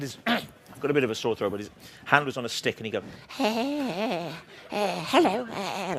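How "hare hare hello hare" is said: high, far-off puppet hare voice; Hartley Hare puppet voice. Retold or imitated. Imitating the TV puppet Hartley Hare for the interviewer, high puppet voice. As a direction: squeak it out like a rabbity puppet hare. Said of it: "he used to talk like this".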